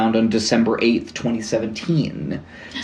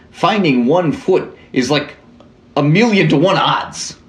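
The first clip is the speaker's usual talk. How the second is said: higher-pitched, hammed-up Canadian accent; bad Canadian accent; 4 semitones higher than usual. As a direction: read it as a caricature Canadian. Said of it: "bad Canadian accent".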